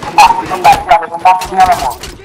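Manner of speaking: urgent, rising yells; excited shouting. Real, not acted. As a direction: urge your teammate to fire, fast. Firefight, urging teammate to shoot. (grida concitate)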